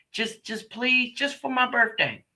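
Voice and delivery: a light imitation of a man begging; mock begging boyfriend. Boyfriend begging on his birthday.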